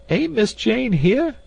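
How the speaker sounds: very high, slow wailing voice; high wailing woman. Acted. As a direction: wail like a terrified old maid. Voiced maid character.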